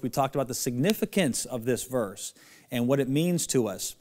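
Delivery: normal talking voice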